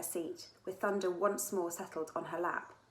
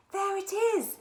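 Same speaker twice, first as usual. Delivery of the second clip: high excited shriek, much louder; thrilled shriek spotting the star; 13 semitones higher, 8 dB louder, slower than usual. A narrator's reading. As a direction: shriek it out with glee. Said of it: "shrieked Cassandra".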